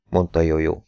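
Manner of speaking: ordinary delivery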